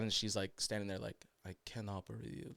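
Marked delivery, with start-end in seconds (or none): 1.5-2.5 put-on very soft immigrant-accented English; quietly stunned, in imitated accented English. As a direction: say it under your breath with an immigrant accent. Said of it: "she barely knew English".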